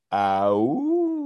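a gentler, lightly voiced wolf howl. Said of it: "more like".

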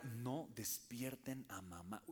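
slow, soft warning; hushed house rule. Retold or imitated. Reciting the family rule to his little sister, slow and hushed. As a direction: lay down the rule in a hush so mom stays asleep. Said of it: "Y la regla era".

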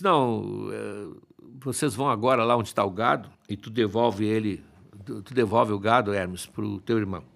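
lightly voiced patriarch, low and contained; Zeus giving orders. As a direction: give a firm order without raising your voice. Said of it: "já é uma ordem direta de Zeus".

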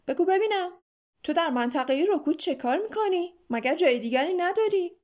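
mildly cross guard questioning an intruder; stern interrogation. A narrator's reading. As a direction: demand an explanation indignantly. Watchdog interrogates the boy.

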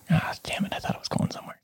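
a quick unvoiced aside after a flubbed joke; whispered aside. Real, not acted. Host flubbing a joke, whispered self-rebuke. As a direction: whisper it to yourself, annoyed. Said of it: "this is why I am not the comedian".

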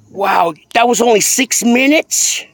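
loud, high burst of real laughter; laughing cry of disbelief; loud laughing shout. Real, not acted. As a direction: howl with laughter. Big laugh, shout heard.

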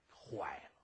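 a killer whispering in shock; horrified whisper. Acted. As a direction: breathe it in dread. Realising he killed her.

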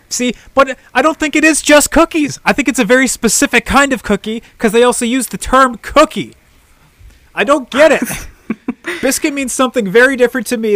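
exasperated. Real, not acted. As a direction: throw up your hands in confusion. Exasperated about British biscuits.

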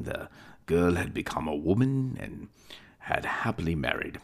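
plain reading voice

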